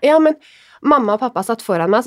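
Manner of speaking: usual speaking voice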